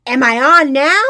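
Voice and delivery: very loud, high blurted question; loud child. A narrator's reading. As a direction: blast it at top volume. Ruby blasts after quiet teacher.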